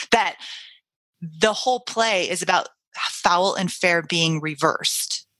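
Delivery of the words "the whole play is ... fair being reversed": ordinary delivery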